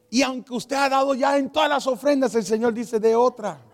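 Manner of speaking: loud, high preacher's call; urgent exhortation. Retold or imitated. Pastor demanding another offering from the congregation, urgent exhortation. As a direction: imitate a pastor pressing the room hard.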